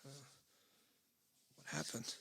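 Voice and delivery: soft, shaken voice fading to a breathless whisper; a stunned young soldier barely getting the words out; shaky and scared. Acted. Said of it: "Kinda shakily".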